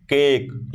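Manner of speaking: "केक" with the ए held long; long vowel. Shown as a demonstration. Teacher demonstrates long vowels, "केक" with a lengthened ए. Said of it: "long time तक आ रहा है".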